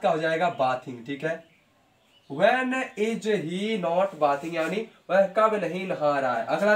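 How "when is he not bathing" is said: stretched out word by word; very slow dictating voice; slow dictation. Shown as a demonstration. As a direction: spell it out slowly for the class. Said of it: "teacher dictating answer".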